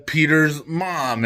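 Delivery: normal talking voice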